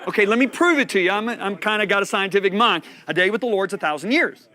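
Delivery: full-force preaching. Real, not acted. Preacher playfully proving a point to the congregation, full-force preaching. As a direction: drive the point out to the whole room.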